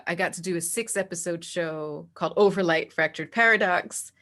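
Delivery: normal talking voice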